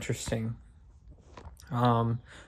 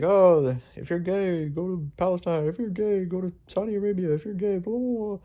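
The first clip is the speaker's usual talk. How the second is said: higher, softened imitation of a heckler; mock megaphone ranter; 6 semitones higher, 3 dB quieter than usual. Trump guy heckling through a megaphone, mocking imitation. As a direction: mimic a repetitive ranter, pitch climbing into blahs. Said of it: "the Trump guy with the megaphone".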